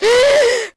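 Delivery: loud high-pitched gasp; dramatic gasp. Acted. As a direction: gasp theatrically out of nowhere. Sudden loud high gasp again.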